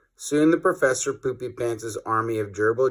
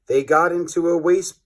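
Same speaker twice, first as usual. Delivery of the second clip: plain reading voice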